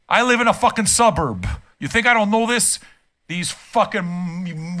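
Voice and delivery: ordinary talk, nothing marked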